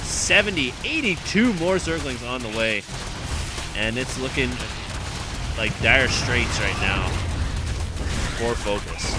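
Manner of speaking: loud, driving esports commentary; live play-by-play. Real, not acted. Caster narrating the battle to viewers, loud driving call. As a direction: keep the energy up through the fight.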